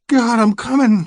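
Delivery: high, loud moaning cry; moaning woman. Acted. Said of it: "she moans".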